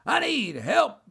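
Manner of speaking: high, mocking echo of a plea; parroted high wail; mimicking a yell. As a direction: mimic someone's cry for help. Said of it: "he starts mimicking me".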